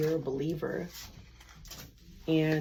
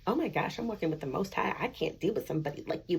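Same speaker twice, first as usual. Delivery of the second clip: ordinary delivery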